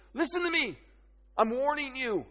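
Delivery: high-pitched urgent warning, acted out; emphatic warning. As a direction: grab their attention like an insistent parent. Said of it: "they want your attention".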